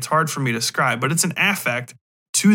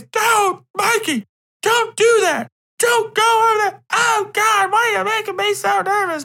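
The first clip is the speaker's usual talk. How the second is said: high shrill-free falsetto panic, much higher; high mock-frantic mother voice; frantic mom. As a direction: squeak out a panicked mother yelling at her kid. Acting out the mother shouting.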